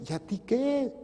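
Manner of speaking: high-pitched indignant comeback; sharp rhetorical rebuke; challenging retort. Acted. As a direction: throw it back in a high voice. Retorts to the complainer.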